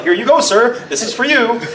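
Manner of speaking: high-pitched, eager-to-please offering; mock servile. Townsfolk appeasing the bomb goblin, mock servile and high. Frightened crowd handing goblin snacks.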